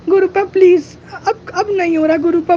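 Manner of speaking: real crying as he pleads with the guru. Real, not acted. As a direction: voice breaking into a sob. Breaking down while begging guru.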